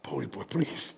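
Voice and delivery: soft, hushed plea; pleading whisper. Retold or imitated. Co-host whispering to him to leave quietly.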